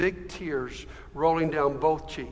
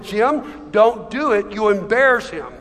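raised, insistent pleading; higher, louder urgent warning; urgent wifely plea; 4 semitones higher, 6 dB louder than usual. A narrator's reading. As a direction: plead with him to hold back. Wife pleads with husband, story.

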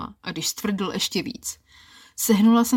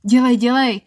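loud, high urgent shout; 4 semitones higher, 8 dB louder than usual. Acted. Woman urges her lover on, loud impatient shout. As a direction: yell it at him, impatient. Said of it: "křikla jsem na něj".

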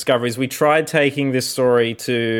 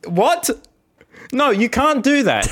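panicked; 9 semitones higher, slower than usual. Real, not acted. Panicked after accidental hang-up.

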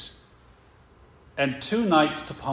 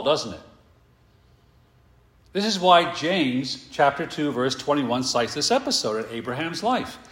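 usual speaking voice

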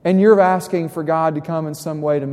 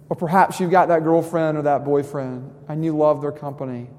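ordinary talk, nothing marked

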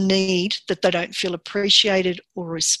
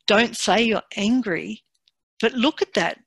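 ordinary talk

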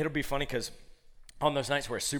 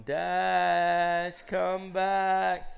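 drawn-out, higher searching call; mock call into the night for a lost pet; 5 semitones higher, 4 dB louder, much slower than usual. Family searching with flashlights, calling out.

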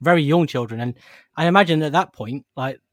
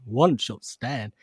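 very slow, soft, hammed-up American hero line; corny American accent, dragged out; over-the-top American accent; 2 semitones lower, 3 dB quieter, much slower than usual. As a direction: drag it out like a cheesy dating-show host in an American accent. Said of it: "strange, over-the-top, like, terrible accent".

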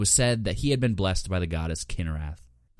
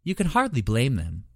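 higher, silky and mocking; purring; 5 semitones higher than usual. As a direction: purr it with amusement. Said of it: "Horavatha purred".

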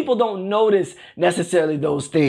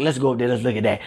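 admiring house guest; 10 semitones lower than usual. Acted. Imagined guest admiring the attic heating system, mock-impressed and low. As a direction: drop way down to marvel like a visitor. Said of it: "They don't come in and say".

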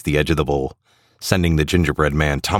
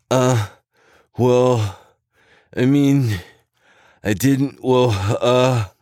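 higher, halting, caught-out stammer; 9 semitones higher, much slower than usual. A narrator's reading. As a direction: stumble over the words nervously. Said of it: "stammers the ugly sweater guy".

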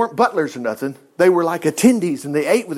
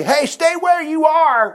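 calling out a warning; 10 semitones higher, slower than usual. Acted. Enemy guards calling a warning.

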